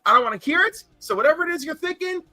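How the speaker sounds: rally the family like nothing's wrong, quick and high; urgent rallying quote. Retold or imitated. Dying aunt reassuring family, retold.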